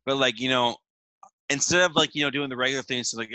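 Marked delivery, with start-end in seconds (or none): none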